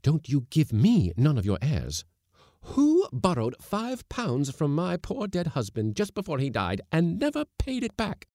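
high, loud accusing tirade; outraged scolding woman; wrathful. A narrator's reading. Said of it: "quivering with wrath".

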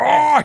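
high, loud mock groan of a ridden beast; big mock cry as the worm gets ridden; mock moan. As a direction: let out a hammed-up high moan. Worm groaning while ridden, mocked.

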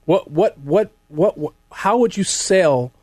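usual speaking voice

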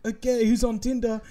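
imitating a host; mock host bit again. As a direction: lightly play the host. Same bit repeated.

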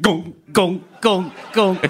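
"gong" noises of a kangaroo-hopping car; spoken sound effect of a stalling lurch; imitated car lurching. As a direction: imitate each jolt as a separate, evenly spaced gong. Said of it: "and it just went".

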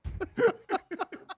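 high burst of real laughter. Real, not acted. Laughs at the bit.

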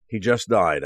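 plain reading voice

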